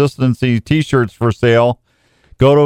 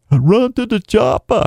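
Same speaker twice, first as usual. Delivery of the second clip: much higher Arnold voice, only lightly done; Arnold impression; 10 semitones higher than usual. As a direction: try an Arnold line, half-hearted. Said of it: "I can't do Arnold".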